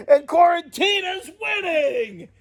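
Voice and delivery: slow, high laughing whine; giggling at his own losing; laughing complaint. Real, not acted. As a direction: laugh through the mock protest. Losing the predictions to her.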